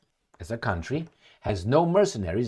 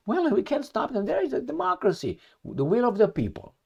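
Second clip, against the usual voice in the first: high, breezy mock shrug of a minister; nonchalant Lecornu; 10 semitones higher than usual. Mocking the French minister for shrugging off volunteers, high nonchalant voice. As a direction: toss it off like an official who couldn't care less. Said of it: "Would you be so nonchalant and say".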